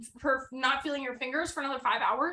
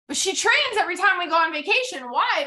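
higher and louder, incredulous; exasperated; 6 semitones higher, 4 dB louder than usual. Real, not acted. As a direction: throw the question at the audience. Exasperated rhetorical question.

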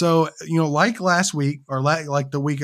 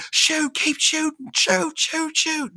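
much higher, strained callout; frantic gamer callout; 12 semitones higher, slower than usual. Retold or imitated. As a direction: imitate a panicked teammate. Mimicking frantic teammate comms.

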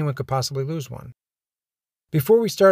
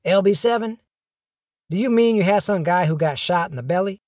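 higher-pitched voice of a questioning dispatcher; dispatcher's reply; 5 semitones higher than usual. Acted. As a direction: play the dispatcher querying the report, pitched up. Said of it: "the dispatcher responded".

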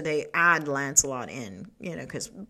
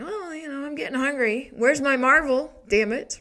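impatient hungry king; 9 semitones higher, 4 dB louder than usual. Retold or imitated. Arthur whining at feast.

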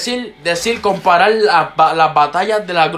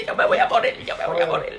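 mock dying; 4 semitones lower, 7 dB quieter than usual. Exageración dramática.

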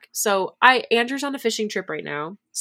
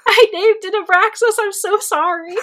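much higher and louder, giggly; gleeful mock apology; giddy confession; 13 semitones higher, 9 dB louder than usual. Real, not acted. Confessing to her friend she named the dinosaur, giddy.

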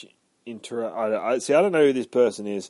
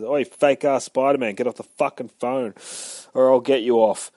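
retold angry biker; 3 semitones higher, 7 dB louder than usual. Biker yells at Deadpool, retold.

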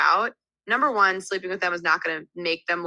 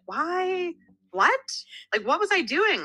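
high-pitched incredulous questioning; baffled disbelief; 11 semitones higher, slower than usual. Acted. Looking back on risky stuff at twenty-two, incredulous. Baffled by her younger self.

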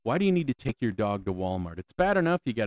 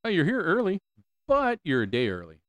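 lightly voiced receptionist, higher on the first words; a hint of a chirpy front desk clerk; mock receptionist telling him he's a day early; receptionist impression; 7 semitones higher than usual. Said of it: "Chick's like".

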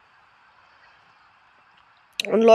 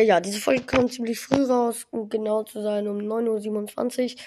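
normal talking voice

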